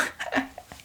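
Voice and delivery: hushed, breathy little laugh; gloating chuckle. Acted. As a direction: chuckle softly over your secret. Zombie gloats over secret hiding place.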